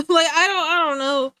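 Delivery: words squeezed out through laughter; can barely talk for laughing; giggling helplessly; laughing hard. Real, not acted. Cracks up at own bit.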